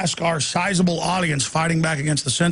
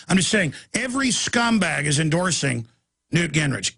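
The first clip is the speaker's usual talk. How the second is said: ordinary talk, nothing marked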